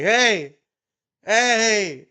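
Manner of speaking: loud, high-pitched drawn-out "hey"; mock shouting. Imitating a man yelling in his sleeping baby's ear, mock shouting. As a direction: yell it like waking a sleeper on purpose.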